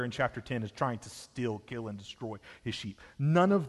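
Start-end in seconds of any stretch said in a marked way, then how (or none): none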